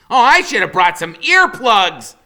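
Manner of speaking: put-on high, loud wisecracking boy; Freak's high smart-aleck voice. A narrator's reading. Boy joking about his own birthday, high and cocky. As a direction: crack the joke like a cocky kid. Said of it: "he says".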